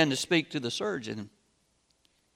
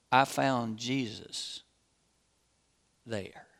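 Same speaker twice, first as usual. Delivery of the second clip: tender answer; 5 semitones lower, 8 dB quieter, slower than usual. A narrator's reading. Surgeon answers boy at bedside.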